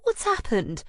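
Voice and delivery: high worried question; a concerned friend asking; alarmed friend. Acted. Anna finding her friend sobbing.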